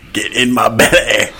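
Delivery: slow, hammed-up Scottish accent; mock Scottish accent. Co-host attempting accents for laughs, mock Scottish accent. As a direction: drag it out like a bad Scot. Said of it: "is that Scottish?".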